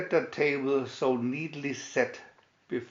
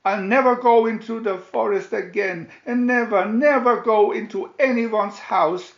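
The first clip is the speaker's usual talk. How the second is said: higher, louder tearful vow, no real sobs; sobbing promise; 8 semitones higher, 8 dB louder than usual. A narrator's reading. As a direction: plead it like a frightened little girl. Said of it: "she sobbed".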